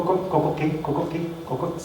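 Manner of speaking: soft, fading co co co like bells; fading rhythmic co co; jingling co co imitation. Shown as a demonstration. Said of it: "la literación".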